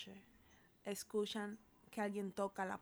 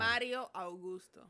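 "Mario Augusto" said slowly, word by word; slow, clear repeat. Shown as a demonstration. Repeating a misheard name to co-host, slow and clear. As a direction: separate "Mario" from "Augusto", drawn out.

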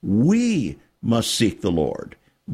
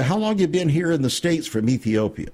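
usual speaking voice

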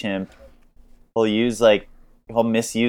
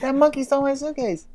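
very high squeaky pig voice; voicing Babe. Babe complaining to the chimp boss, squeaky and high. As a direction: whine it like a wronged little pig. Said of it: "he's like".

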